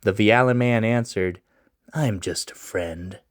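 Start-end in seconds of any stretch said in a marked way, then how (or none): 1.9-3.2 low, slow and hushed stranger; mysterious stranger. Acted. Mysterious stranger answering Loris, low and slow. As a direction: keep it low and secretive.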